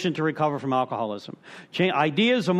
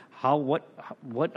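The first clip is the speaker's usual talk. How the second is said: ordinary talk, nothing marked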